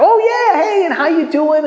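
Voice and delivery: high-pitched mock-excited greeting; delighted recognition. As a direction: act out suddenly recognizing an old friend, pitch leaping up. Acts out recognizing someone, greeting them.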